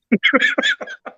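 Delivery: high, loud burst of real laughter; cracking up at the meme joke; can't hold back a loud laugh. Real, not acted.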